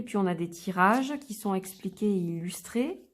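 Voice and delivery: ordinary talk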